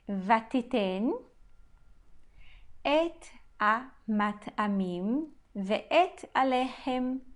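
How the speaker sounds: slow, word-by-word Hebrew reading; careful verse reading. Shown as a demonstration. Teacher reading a Hebrew verse to students, slow and deliberate. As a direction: read the verse out slowly, with pauses between phrases.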